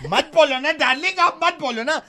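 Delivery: high-pitched, whiny scolding; petulant boyfriend. Acted. Jealous boyfriend butts into a customer's call, petulant whining.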